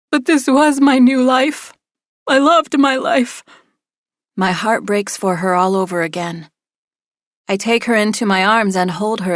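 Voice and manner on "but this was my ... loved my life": high, tearful pleading, on the edge of sobbing. A narrator's reading. Distraught friend crying to the narrator, high tearful wail. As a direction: let the voice crack with tears. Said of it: "The tears flood her cheeks".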